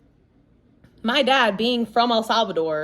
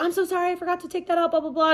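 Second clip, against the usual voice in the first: husband's apology; 7 semitones higher, much faster than usual. Retold or imitated. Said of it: "he's like".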